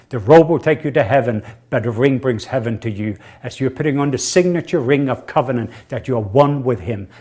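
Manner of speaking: usual speaking voice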